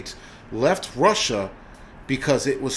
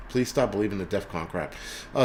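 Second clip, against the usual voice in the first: normal talking voice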